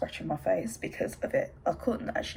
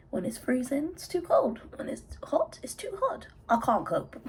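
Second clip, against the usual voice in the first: whiny complaining; 8 semitones higher than usual. Pretend. Mocking Brits complaining about weather.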